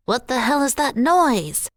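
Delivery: terrified tiny dog hearing the tuning fork; frightened cry. A narrator's reading. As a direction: yelp it out in fright. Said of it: "Rocco cried".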